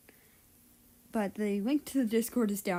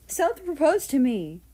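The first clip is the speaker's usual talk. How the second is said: high, loud, bursting announcement; excited news; 6 semitones higher, 8 dB louder than usual. Acted. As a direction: blurt out the big news with joy.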